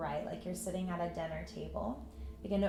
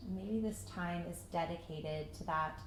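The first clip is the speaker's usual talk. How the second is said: calm meditation voice with long pauses; unhurried, even guidance into stillness; guided meditation; 5 dB quieter than usual. Acted. Yoga teacher settling students into breath and patience, guided meditation.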